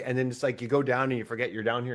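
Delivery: ordinary talk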